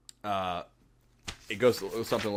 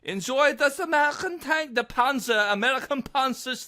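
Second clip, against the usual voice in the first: much higher, hammed-up gloating voice; mock soldier taunt; 13 semitones higher than usual. German soldier gloating at the SS, much higher mock taunt. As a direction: gleefully jeer like a cartoon soldier. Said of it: "you imagine a German guy saying it".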